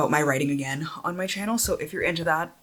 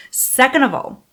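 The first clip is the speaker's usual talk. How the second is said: playful exclamation; 8 semitones higher, 3 dB louder than usual. Real, not acted. Playful list after "first of all.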